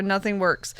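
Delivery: usual speaking voice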